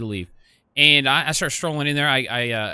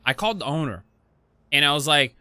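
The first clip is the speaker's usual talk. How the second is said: normal talking voice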